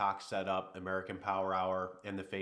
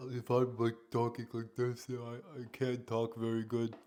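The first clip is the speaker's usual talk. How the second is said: a hint of a croaky sick voice, lightly done; sick morning voice. Shown as a demonstration. Streamer demos his sick pre-caffeine voice, mildly croaky. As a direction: show how rough he sounded before caffeine. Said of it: "That's what I sounded like this morning".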